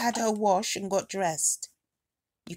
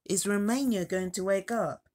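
ordinary delivery

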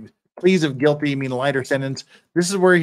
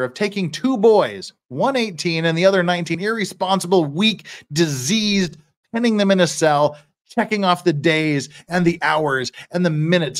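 raised, forceful plea with weighted phrases; impassioned courtroom oratory; 4 semitones higher, 4 dB louder than usual. Acted. Defender picturing two boys awaiting hanging, impassioned oratory.

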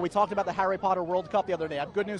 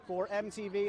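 ordinary delivery

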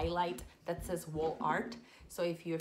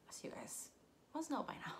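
quiet aside; 6 semitones higher, 10 dB quieter than usual. Real, not acted. Aside about her Spanish.

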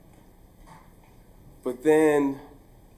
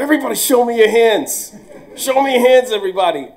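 high, loud order to the room; commanding shout; 12 semitones higher, 10 dB louder, faster than usual. Retold or imitated. Teacher ordering students in the cafeteria, loud high command. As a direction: bark it at the whole cafeteria.

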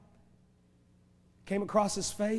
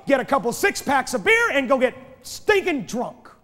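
loud, high shouted testimony; testifying man shouting; 7 semitones higher, 11 dB louder than usual. Retold or imitated. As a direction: shout the old sinful life out. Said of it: "One man said".

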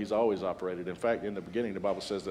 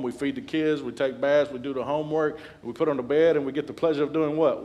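normal talking voice

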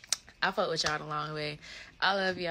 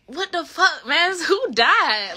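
loud, high shocked cry; shocked outburst; 10 semitones higher, 12 dB louder, much slower than usual. Retold or imitated. Reliving her shock at the news, loud and high.

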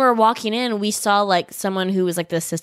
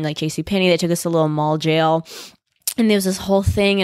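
ordinary talk

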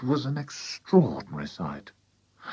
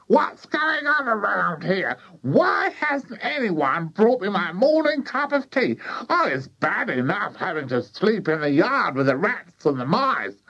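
put-on high, strident complaining old woman; shouting grandma; 10 semitones higher than usual. A narrator's reading. Grandma demanding her morning tea, high indignant shout. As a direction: wail and scold in a high grandma voice. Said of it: "she shouted".

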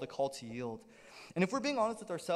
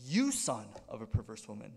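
low, stern mock rebuke; imitated disowning reproach; upset, displeased father; 8 semitones lower than usual. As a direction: lean on "You son" in low, controlled displeasure. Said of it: "He's so upset, he's so displeased".

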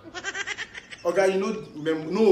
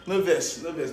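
ordinary delivery

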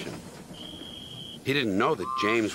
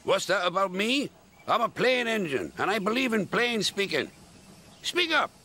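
high, indignant little engine; bristling and blunt, pitched right up; Duncan; 9 semitones higher than usual. A narrator's reading. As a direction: play a huffy engine. Voices Duncan, cross engine.

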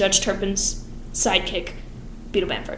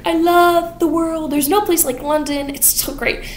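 lightly voiced Anthony; 7 semitones higher than usual. Anthony voiced in fast retelling.

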